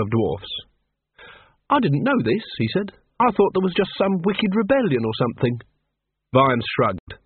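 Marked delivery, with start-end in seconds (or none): 1.7-5.6 higher, lilting innocent voice; lightly voiced wide-eyed learner; naive character. Carrot's naive surprise at history.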